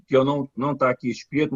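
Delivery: ordinary delivery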